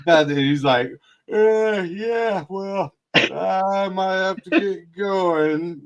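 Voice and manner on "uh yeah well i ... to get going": high, slow, backing off sheepishly; imitating a big guy suddenly timid; mock nervous retreat. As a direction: squeak out an excuse to leave.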